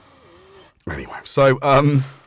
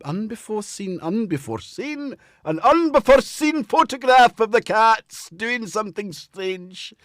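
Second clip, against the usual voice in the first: high-pitched hammed-up announcing; mock announcement; 9 semitones higher, 4 dB louder, slower than usual. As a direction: savour the odd phrase in a silly high voice. Playfully announces made-up phrase.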